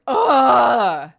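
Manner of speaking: loud, exasperated groan and yell; mock teen outburst. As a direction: imitate a bratty teen yelling at mom. Teen child snapping at parent.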